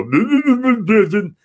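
high, loud mocking blah blah; mocking bullies. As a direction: jeer like a schoolyard bully. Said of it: "they'd go".

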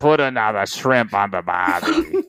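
put-on slow, drawn-out stock Aussie accent; stretched-out Australian catchphrase voice. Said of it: "Give us your Australian".

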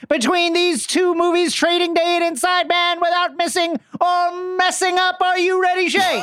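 1950s boxing announcer. Acted. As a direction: introduce the fighters. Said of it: "a nineteen fifties boxing announcer".

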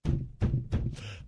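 wheezing, near-silent laughter at his own joke; cracking up at own pun; breathless silent laugh. Real, not acted. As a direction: laugh without voice. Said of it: "Just making me laugh".

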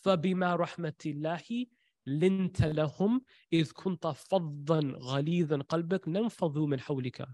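quick flowing Quran recitation; Quranic recitation. Acted. Preacher to congregation, quoting the verse in a fast recitation.

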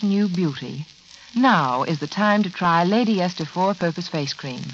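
ordinary delivery

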